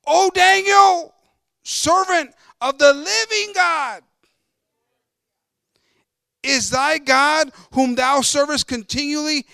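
loud, drawn-out cry, pitched high; lamentable cry. A narrator's reading. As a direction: cry it out slowly as the anguished king from scripture. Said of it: "with a lamentable voice unto Daniel".

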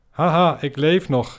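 loud high cackling laugh into the line; a gloating head laughing it off; gleeful laugh. Acted. Severed head gloats it survives.

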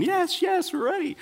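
put-on high-pitched little kids' voice; excited kids. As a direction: imitate eager children, squeaky high. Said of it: "all the kids and the grandkids are going".

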